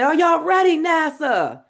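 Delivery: loud, high rallying shout; hype call. Shown as a demonstration. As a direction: fire the crowd up. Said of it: "And so I say".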